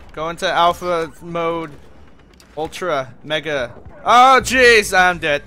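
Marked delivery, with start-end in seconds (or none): 4.0-5.4 sudden loud yelp of alarm; startled yell. Real, not acted. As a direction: yell out, caught off guard. Gets killed in game.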